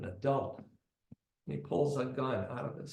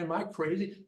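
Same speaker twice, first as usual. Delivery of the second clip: ordinary delivery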